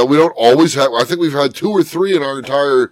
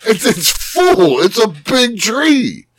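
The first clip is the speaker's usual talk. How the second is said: high pleading defense; 9 semitones higher, slower than usual. Retold or imitated. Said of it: "I go".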